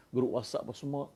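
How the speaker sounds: normal talking voice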